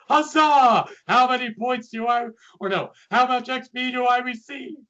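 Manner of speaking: high, loud regal gamer cheer; cute anime streamer. Pretend. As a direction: boom out a royal victory cry. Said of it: "she would just be like".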